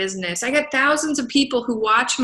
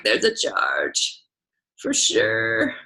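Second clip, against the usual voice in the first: much lower, slow, half-whispered deadpan; mock deep voice; 13 semitones lower, much slower than usual. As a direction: drop the voice low and smug to name the price. Telling big names about his fee.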